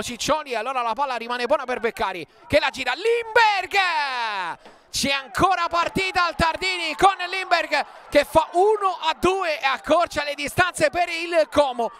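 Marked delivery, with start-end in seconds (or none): 2.9-11.9 sudden high shout of the name; goal commentary shout. Real, not acted. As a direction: burst out with the scorer's name. Como pulls one back.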